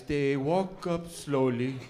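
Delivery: stretched slow narration; slow drawn-out. Retold or imitated. Comic describing players lining up, slow drawn-out voice. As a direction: drag the words like a slow march.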